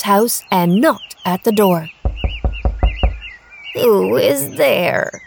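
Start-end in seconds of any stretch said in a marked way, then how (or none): 3.7-5.2 put-on old grandmother voice. A narrator's reading. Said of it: "cried grandmother".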